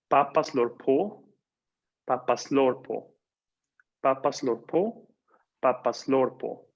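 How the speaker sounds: slow, alternating rise and fall; contrasting stress takes. Shown as a demonstration. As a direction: repeat "papaslorpo" slowly, alternating the tune. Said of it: "¿Se escucha la diferencia?". ("papaslorpo" lento, alternando subida y bajada)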